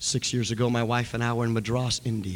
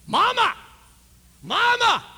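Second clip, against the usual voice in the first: put-on much higher, louder child's cry; child's scream; 9 dB louder than usual. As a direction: call out like a thrilled little boy.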